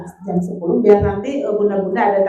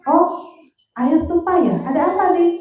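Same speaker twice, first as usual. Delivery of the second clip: put-on higher, bright caring mother to a child; a mother asking her child about spilled water, higher and caring; gentle mother voice; 7 semitones higher than usual. Shown as a demonstration. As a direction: sound kind and curious so the child isn't afraid. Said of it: "Saya udah ada di fase begini".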